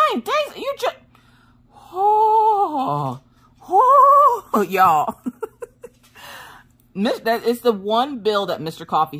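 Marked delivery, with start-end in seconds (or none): 0.1-0.9 high-pitched, sputtering disbelief; incredulous outburst. Retold or imitated. Wife hearing payment is days late, incredulous outburst. Acting shock at overdue payment. 1.9-4.6 high, drawn-out exasperated "oh"; loud wail of frustration; exasperated cry. Retold or imitated. Wife fed up with husband, exasperated wail.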